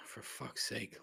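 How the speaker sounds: sighing, whispered for fuck's sake; real whispered exasperation; exasperated whisper. Real, not acted. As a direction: breathe it out in quiet disgust. Exasperated sigh.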